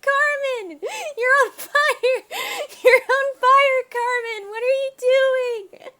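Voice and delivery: high, loud laughing shrieks; laughing panic. Real, not acted. Player shrieking at Carmen between laughs, panicked. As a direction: yell through laughter. Yelling at character on fire.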